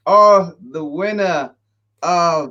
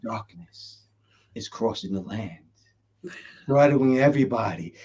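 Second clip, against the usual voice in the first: hushed, low, ominous narrator voice; dark prophecy, very soft and low; Quinn; 8 semitones lower, 7 dB quieter than usual. Shown as a demonstration. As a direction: drop way down, near a whisper, with menacing gravity. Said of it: "Then I did the Quinn voice".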